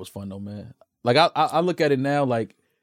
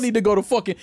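ordinary talk

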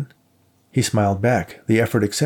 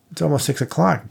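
higher, whiny protest; complaining; 7 semitones higher than usual. A narrator's reading. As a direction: grumble that it's too late. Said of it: "Dave complained".

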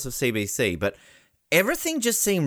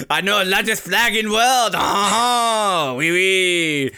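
a hammed-up German-French accent, louder; a mock Schwarzenegger-style German-French accent; 5 semitones higher, 4 dB louder, much slower than usual. Joking about French organisers to a co-host, mock German-French accent. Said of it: "now it's Arnold Schwarzenegger".

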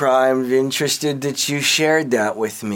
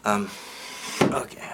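ordinary delivery

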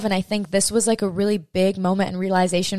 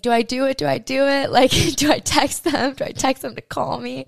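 nervous excitement; 6 semitones higher than usual. Retold or imitated. Anxious self-quote about texting.

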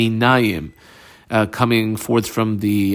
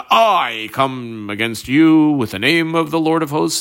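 emphatic reply, lightly acted; David; 3 semitones higher than usual. David answers Goliath, lightly acted defiant declaration. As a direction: hit I hard and stretch it, lightly declaiming. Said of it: "David continued and said".

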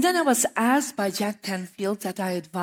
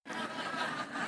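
short unvoiced laugh; 7 semitones higher, 14 dB quieter than usual. Real, not acted. Laughs about buying more Oscars.

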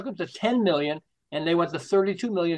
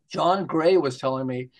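normal talking voice

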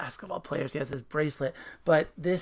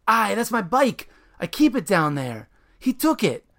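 loud, higher upset complaint; angry super; 6 semitones higher, 7 dB louder than usual. Acted. Super complaining his bike was stolen, angry. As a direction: vent about the stolen bike.

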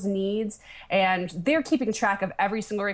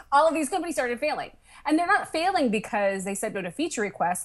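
usual speaking voice

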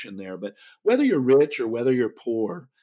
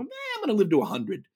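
put-on quick, higher voice, playfully aping an optimistic old man; mock old-timer; 11 semitones higher, faster than usual. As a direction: toss it off fast and high like a cocky eighty-five-year-old. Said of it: "eighty-five-year-olds probably go".

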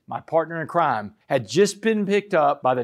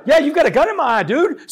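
loud, high retort with a laugh in it; laughing yell; 10 semitones higher, 6 dB louder than usual. Retold or imitated. Speaker tells the cop about the gun, laughing shout. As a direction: yell it back half laughing. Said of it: "I screamed back at him".

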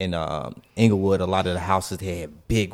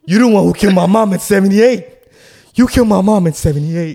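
loud, higher accusing shout; accusing yell; 9 semitones higher, 8 dB louder than usual. Retold or imitated. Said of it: "I wanna stay in character".

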